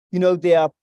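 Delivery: ordinary delivery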